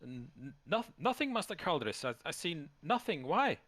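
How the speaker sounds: high, stammered shout from aloft; high-pitched distant reply; lookout calling back. Acted. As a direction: call down from the crow's nest, stumbling at first. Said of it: "the reply comes back".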